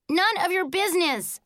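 high-pitched mild yell. A narrator's reading. Child in closet rebuffing brother, high yell. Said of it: "I yelled".

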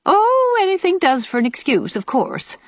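high swooping "oh", then settling; mock amiable. A narrator's reading. Old aunt to girls explaining their departure, amiably cutting. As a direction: sweetly wave away their excuse. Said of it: "said Aunt Atossa amiably".

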